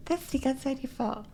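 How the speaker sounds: very high, soft voice. Shown as a demonstration. Teacher pretending at a future default, very high soft voice. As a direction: lift the pitch way up, lightly and softly. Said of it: "Eventually it gets higher".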